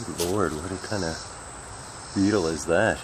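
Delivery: normal talking voice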